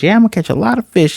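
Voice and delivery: quick, high-pitched eager echo; eager reply. Retold or imitated. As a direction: imitate an excited kid agreeing. Acted eager echo of the question.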